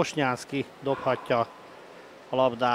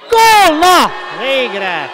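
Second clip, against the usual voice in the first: goal shout; 9 semitones higher, 11 dB louder, much slower than usual. Real, not acted. Goal celebration by commentator.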